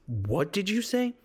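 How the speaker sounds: stunned, rising incredulity; mock shock. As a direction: ask it slowly, as if scandalized. Acts out own shocked reaction.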